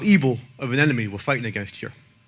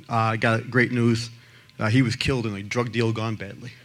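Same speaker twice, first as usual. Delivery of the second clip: ordinary delivery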